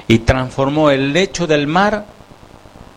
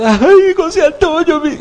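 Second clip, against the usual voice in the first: hammed-up soap opera wail, much higher; mock telenovela heroine. Mocking crying women in soap operas, hammed-up wail. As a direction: swoon out the name like a soap star. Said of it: "porque en las novelas".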